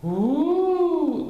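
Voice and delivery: loud high woo; high ghostly whoop. A narrator's reading. Spooky moan in the dark, high and loud. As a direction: howl it like a ghost.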